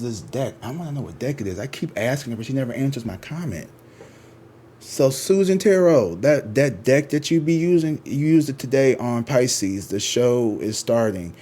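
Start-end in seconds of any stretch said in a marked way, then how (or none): 4.8-8.3 calling out. Real, not acted. Calling out to a YouTuber.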